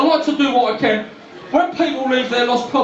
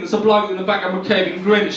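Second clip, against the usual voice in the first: normal talking voice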